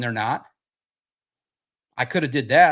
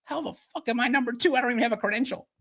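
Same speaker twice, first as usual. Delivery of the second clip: mock disbelief; 9 semitones higher, 3 dB quieter, faster than usual. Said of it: "is gonna be like".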